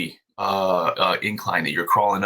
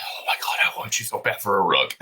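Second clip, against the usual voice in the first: hushed awe. Retold or imitated. Retold awe at the bear.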